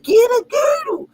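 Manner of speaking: high cracking mock-cry of a mother; imitating mom. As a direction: beg like an insistent mother. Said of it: "She said".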